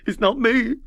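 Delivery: high, loud tearful wail; sobbing. Acted. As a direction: protest through sobs. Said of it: "he sobbed".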